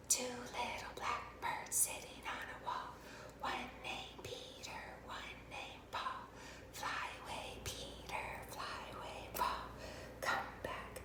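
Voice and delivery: slow whispered finger-play rhyme; hushed nursery chant, all breath. Shown as a demonstration. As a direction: whisper the rhyme very softly to children. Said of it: "we're gonna use our whisper voice".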